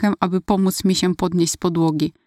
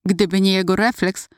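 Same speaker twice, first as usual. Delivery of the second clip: ordinary delivery, nothing marked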